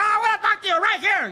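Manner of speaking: high mock yell; mock angry parent. Parent calling the child over, high hammed-up anger. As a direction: holler it like a parent laying down the law.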